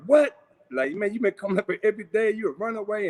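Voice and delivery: shocked realisation. Retold or imitated. Brother learning the kid hitchhiked from St. Louis, stunned. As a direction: it just dawned on him.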